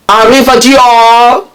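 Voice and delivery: hammed-up French brand goodbye, drawn out; mock French farewell. Dior brushes off Johnny Depp, in a mock French accent. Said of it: "Dior said".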